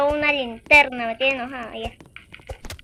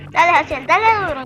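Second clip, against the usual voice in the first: cheering; 7 dB louder than usual. Real, not acted. Cheering on a player.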